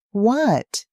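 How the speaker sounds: sharp high shocked cry, loud; screaming. A narrator's reading. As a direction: scream it out in disbelief. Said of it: "Diana screams".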